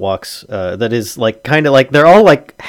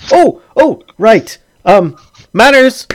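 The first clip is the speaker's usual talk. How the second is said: high, loud and flustered husband; suddenly remember yourself, pitched up and loud; flustered realization; 12 semitones higher, 8 dB louder, slower than usual. Acted. Chided husband suddenly remembers his manners.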